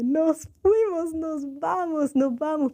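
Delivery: high, stretched-out call to go; retold urgent call. Woman seizing her escape from smoking, excited retold call. Urging everyone to leave, retold.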